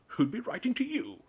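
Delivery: high, loud sneer. A narrator's reading. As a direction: scoff at the boy. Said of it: "sneered Uncle Vernon".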